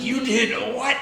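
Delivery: high, loud mock disbelief with a laugh; mock outrage. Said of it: "They're like".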